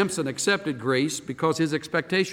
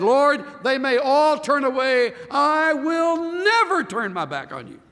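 Peter's fervent vow; 12 semitones higher, 5 dB louder than usual. Retold or imitated. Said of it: "Peter said".

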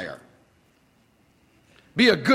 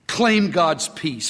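usual speaking voice

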